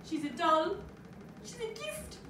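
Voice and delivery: put-on hushed, high awe at being handed a baby; impression of mum. New grandmother handed the baby, cooing high. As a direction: coo it high, pausing between thoughts. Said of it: "she said".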